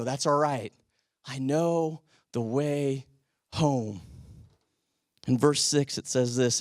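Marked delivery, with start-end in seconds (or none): 0.0-4.0 slow, low and gentle; tender reassurance. Retold or imitated. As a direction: soothe a tired friend, almost slowly lulling. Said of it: "we throw them on our shoulders and we go".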